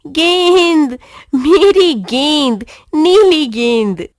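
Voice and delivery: loud, slow, drawn-out cry of joy; overjoyed. A narrator's reading. Boy bursting with joy over his new blue ball. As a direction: show off the new ball, thrilled. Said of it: "उत्साह के मारे हवा में उड़ता हुआ".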